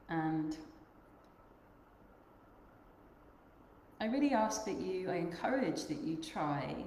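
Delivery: unhurried meditation guide, long pauses between phrases; guided meditation. Acted. Yoga teacher guiding the group to notice their breath, guided meditation. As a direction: lead them gently inward, leaving long silences.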